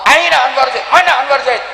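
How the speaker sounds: high, quick calling out; searching call. Acted. Preacher voicing God searching for him. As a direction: look around and call the name. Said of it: "ketika Allah".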